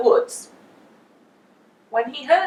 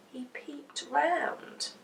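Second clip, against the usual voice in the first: whispered creeping; 4 semitones higher, 10 dB quieter, much slower than usual. A narrator's reading. Narrator creeping quietly.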